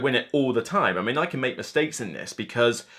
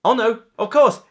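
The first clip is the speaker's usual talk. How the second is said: ordinary delivery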